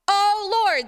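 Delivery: loud, high narrated cry; pleading. A narrator's reading. Sailors crying out to Jonah's God, pleading. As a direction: call out in a mild plea. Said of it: "they pleaded".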